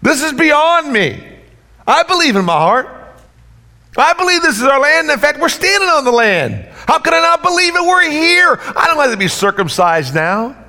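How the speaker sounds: high, loud mock protest from an old man; preacher voicing an old objector, pitched high with shouts; reluctant old man. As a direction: ham up a grumbling old man who is too old for this. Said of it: "Nobody said".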